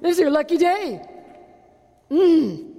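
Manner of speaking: gasping, sugary fake excitement; mock the disciples' sudden sweetness; hammed-up phony delight; mock fake enthusiasm. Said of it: "they're like".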